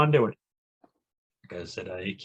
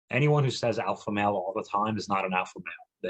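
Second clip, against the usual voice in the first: ordinary talk, nothing marked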